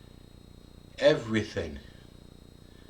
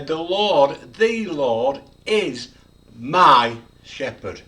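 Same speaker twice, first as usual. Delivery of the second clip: "the", "is" and "my" each loud, slow and separate; every word stressed; 8 dB louder, slower than usual. Shown as a demonstration. Preacher showing listeners how to say the psalm, every word stressed. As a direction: hammer "the Lord", then "is", then "my". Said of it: "You could emphasize every word".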